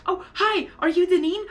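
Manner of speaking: high, friendly introduction; pretend polite greeting. Fan imagines greeting the actress, high polite voice. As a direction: pitch up for a sweet hello.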